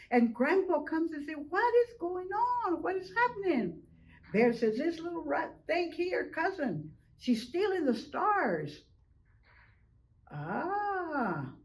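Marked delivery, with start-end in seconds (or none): 1.5-3.8 put-on high, startled old man calling out; a much higher voice, alarmed at the noise; startled Grandpa. Grandpa demanding to know what the ruckus is, high and startled. 4.8-8.8 higher, tattling accusation; accusing Bear. Acted. Bear telling Grandpa Raccoon is stealing stars, high and accusing. 10.3-11.5 caught cry. Acted. Raccoon cries when caught.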